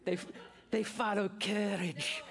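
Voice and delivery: a hammed-up Braveheart Scottish brogue; a pastor doing a bad Scottish accent for his congregation. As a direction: play William Wallace with a mock Scottish accent. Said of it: "for that horrible accent".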